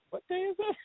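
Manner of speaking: high, soft imitation of someone clueless; small high voice, comically lost; mock cluelessness. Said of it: "He just doesn't care".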